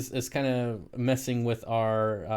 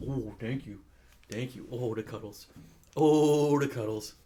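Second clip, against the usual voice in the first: tender dog cuddles; 4 dB quieter than usual. Real, not acted.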